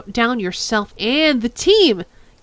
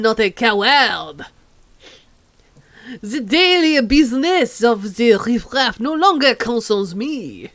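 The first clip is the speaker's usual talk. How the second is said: bad French accent; 4 dB louder than usual. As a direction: play the proud French old man. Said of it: "over-the-top bad French accent".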